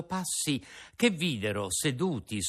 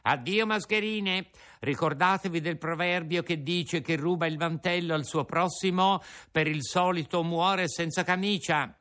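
mocking Pinocchio; 7 semitones higher than usual. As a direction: wave them off with scorn. Pinocchio taunts.

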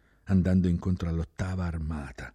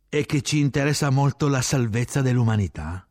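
sustained loud, high delivery; shouted explanation; 8 semitones higher, 7 dB louder than usual. A narrator's reading. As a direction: keep shouting it over the noise. Adds more over the din.

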